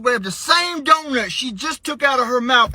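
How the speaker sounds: ordinary talk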